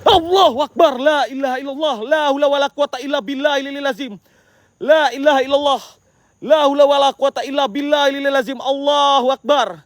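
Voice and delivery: high, chanted prayers called out; chanting Islamic phrases into the dark. Real, not acted. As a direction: recite for protection, raising the voice. Reciting prayers out loud for protection.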